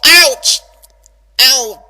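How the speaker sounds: sharp loud yelp of pain; loud ouch. Acted. Rainbow stung by a bad twinge, loud yelp. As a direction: cry out at the sudden stab. Tooth pain jolts her.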